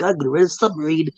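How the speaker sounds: tossed-off mock customer line; mock renter quote. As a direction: play the clueless buyer. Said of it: "be like".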